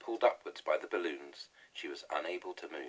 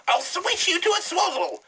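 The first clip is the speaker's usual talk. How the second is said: much higher, louder furious witch yell; yelling hag; 14 dB louder than usual. A narrator's reading. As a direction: bellow insults from the sky like a wild hag. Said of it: "she shouted".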